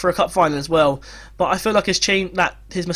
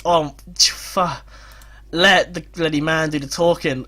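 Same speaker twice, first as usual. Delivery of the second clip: ordinary delivery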